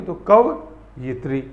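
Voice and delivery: कवयित्री slowly with the य clearly sounded; careful pronunciation. Shown as a demonstration. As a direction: say कवयित्री slowly, stressing the यि syllable. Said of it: "तो कवित्री बोलते हैं". (कवयित्री धीरे, यि साफ़ बोलते हुए)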